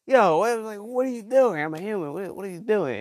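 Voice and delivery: mock alarm. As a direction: play the startled abductee. Acted alarm at being abducted.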